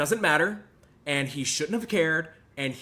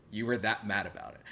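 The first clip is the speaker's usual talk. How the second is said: normal talking voice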